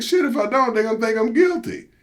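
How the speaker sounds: high, anxious fretting; a panicky squeak of worry; retelling his alarm at looking guilty. Retold or imitated. Retold alarm, high pitch.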